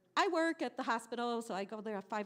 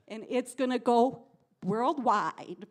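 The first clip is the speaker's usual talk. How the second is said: ordinary delivery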